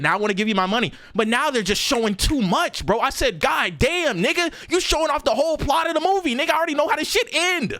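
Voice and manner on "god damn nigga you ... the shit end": exasperated rant. Retold or imitated. Exasperated rant at trailer reveals.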